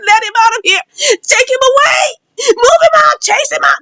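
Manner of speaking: high, urgent acted commands; shouted orders. Guy ordering the attacker thrown out, shouted orders. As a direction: order him out, pitch climbing with each command.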